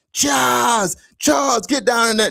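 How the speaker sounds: high, drawn-out frantic yell; imitated screaming. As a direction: call out like a screaming friend in an emergency. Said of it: "Then he starts screaming this".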